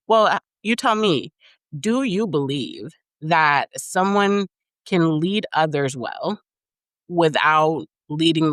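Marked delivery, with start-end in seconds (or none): none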